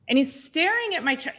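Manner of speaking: normal talking voice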